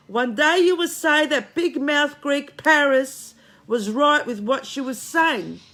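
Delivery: loud, slow, every word driven home; emphatic slow declaration. Real, not acted. Telling her followers she'll be proven right, loud and slow. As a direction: hammer it in like a vow of being proven right.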